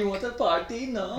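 high-pitched vague European party guy; questioning, high mock-European lilt; mock European accent. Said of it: "every time I do that voice".

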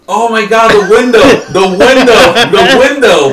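high, loud panicked cry breaking into laughter; screaming. Retold or imitated. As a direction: yell it in pain while laughing. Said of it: "Jonathan was screaming, "The window".

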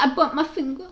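high, childish imitation voice; little kid. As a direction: mimic a small child owning up.

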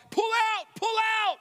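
high-pitched urgent shout; mock alarm. Man trying to escape the salesman, alarmed shouting.

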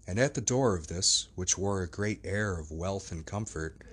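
ordinary delivery

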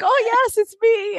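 very high little put-on voice; high-pitched mock séance voice; little spirit voice. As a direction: answer as a fake little spirit. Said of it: "[name] does the little voice".

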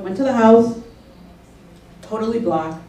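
ordinary delivery

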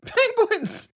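high laughing exclaim; squealed laugh. Real, not acted. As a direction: burst out laughing on it. Laughing at the penguins joke.

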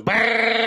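quick rattling gun burst; playful mouth-made gunfire; machine gun noise. Retold or imitated. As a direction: imitate a Tommy gun firing. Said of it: "machine gun, Tommy gun".

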